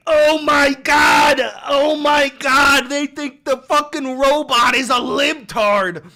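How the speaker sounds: slow, loud disbelieving yell; outraged shout. Real, not acted. Streamer bellowing at chat's claim about AI.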